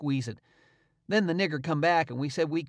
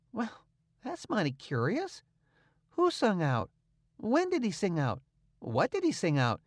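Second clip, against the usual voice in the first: puzzled; 5 semitones higher than usual. A narrator's reading. Tom pretending to the servant to be puzzled. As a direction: act baffled on purpose. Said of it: "in a puzzled up kinda way".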